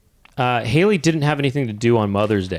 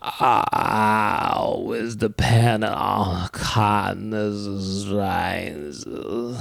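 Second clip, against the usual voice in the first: very slow, fading soft, put-on voice; blended Andy Dick and Tennessee Williams voice; 3 semitones lower, 4 dB quieter, much slower than usual. Showing the mix of both voices, very slow and fading. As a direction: drag the line out, then let it fade to almost nothing. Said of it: "when it sounds a little bit like this".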